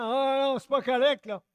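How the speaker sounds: a hint of an angry candidate; mock outraged politician. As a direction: play the sore loser crying foul. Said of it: "en disant".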